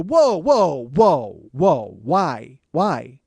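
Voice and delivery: loud, stunned whoa whoa; sharp disbelieving cry of whoa; shocked outburst. Retold or imitated. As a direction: pull up hard, stunned by the fee. Shocked at the hundred-dollar fee.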